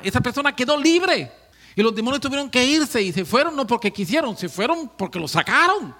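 loud, high fervent sermon; preaching at full force. Acted. Preacher telling of demons cast out, full-force preaching. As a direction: drive the story with fire.

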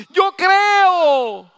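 grito alto y lento, suplicante desde el cielo; Dios gritando. Acted. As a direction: clama obedéceme, muy alto y alargado. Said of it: "Dios está gritando desde el cielo". (Dios grita desde el cielo a su pueblo, más alto y lento)

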